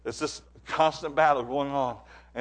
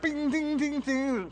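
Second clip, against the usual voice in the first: high, bouncy ding-ding sound effects; pinball noises; 12 semitones higher, slower than usual. Retold or imitated. Telling how the fighter tossed him around, in high pinball dings. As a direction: bat the words around like a pinball machine. Cat batting mouse like pinball.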